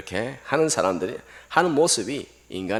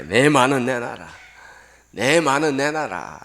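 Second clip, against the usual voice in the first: loud, insistent yelling of the demand; demanding shout; 5 semitones higher, 8 dB louder than usual. Retold or imitated. Villager demanding the money he thinks is his, loud shout. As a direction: shout to get your money back. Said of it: "고함을 질렀습니다".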